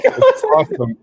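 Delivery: high squeaky giggle through the words; giggling. Real, not acted. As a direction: break into a giggle mid-word. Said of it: "I've been kinda giggling and laughing".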